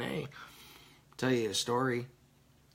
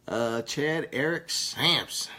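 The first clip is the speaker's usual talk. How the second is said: ordinary talk, nothing marked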